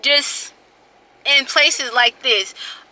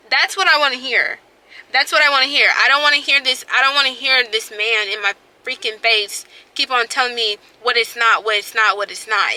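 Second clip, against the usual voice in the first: ordinary delivery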